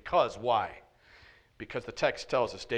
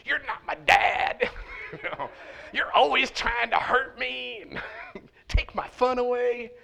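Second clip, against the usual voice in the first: mock teenage outburst, pitched up high; hammed-up teen tantrum; 10 semitones higher than usual. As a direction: play a bratty kid shouting back. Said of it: "the son's like".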